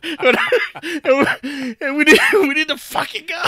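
high, loud, hammed-up demand; mock angry yelling. Wife in the car demanding to leave, mock furious shout. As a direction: imitate the wife shouting, close to laughter. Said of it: "he could hear her yelling".

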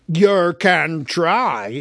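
loud, slow menacing troll. A narrator's reading. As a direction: draw it out with menace. Bert grabs a skewer.

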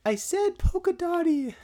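put-on high squeaky child voice; whiny little kid pleading. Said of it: "Your pre-puberty voices".

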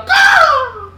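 high shrieking caw; loud bird caw. Retold or imitated. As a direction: screech it like a hawk. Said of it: "They go".

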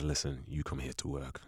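low, quiet, menacing villain; a stern boss, low and cold. Retold or imitated. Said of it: "like, a villain voice".

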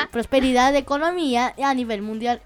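ordinary talk, nothing marked

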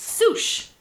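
a sudden sneeze mid-word; sneezing. Real, not acted. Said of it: "She sneezed in the middle of a sentence".

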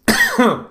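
sharp cough; a quick cough into the mic. Real, not acted. Excuse me.

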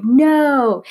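mock toddler yelling no; toddler's loud no. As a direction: shout it like a stubborn toddler. Toddler voiced refusing change.

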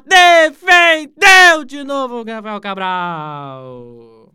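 fast call building to a shout; live play-by-play. Acted. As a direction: call the corner and the save.

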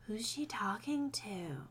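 slow, voiceless whisper. A narrator's reading. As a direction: whisper a puzzled question to yourself. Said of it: "Mark whispered".

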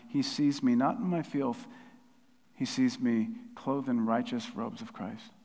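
ordinary talk, nothing marked

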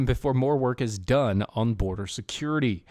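ordinary talk

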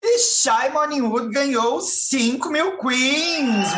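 slow, proud prize call; booming prize announcer. Acted. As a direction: announce the winnings like a game show.

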